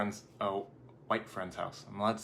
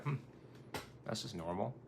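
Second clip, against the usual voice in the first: understated, much softer acted reply; unbothered older people; 13 dB quieter than usual. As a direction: imitate someone mildly accepting it.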